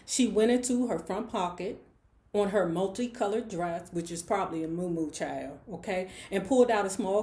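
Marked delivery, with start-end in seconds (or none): none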